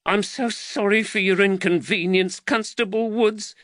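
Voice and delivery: high-pitched, distressed woman; troubled mother begging pardon; anguished apology. A narrator's reading. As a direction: apologise to the constable in a high, fretful voice. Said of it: "Anguish flooded across Jaquetta's face".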